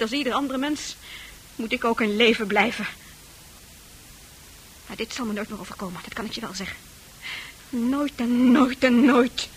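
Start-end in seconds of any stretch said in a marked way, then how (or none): 4.9-6.8 bitter low vow. Acted. 7.7-9.5 bitter, deliberate triple never; slow repeated never. Acted.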